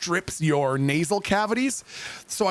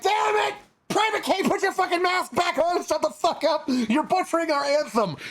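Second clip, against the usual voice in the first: much higher mock yell of an angry instructor; angry drill instructor; 12 semitones higher than usual. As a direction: bellow at the recruit to shut up. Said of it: "the instructors with their masks on are like".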